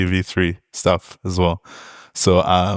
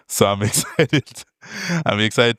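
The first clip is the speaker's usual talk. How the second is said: laughing through it; 2 semitones higher than usual. Real, not acted. Laughing mid-sentence while self-joking.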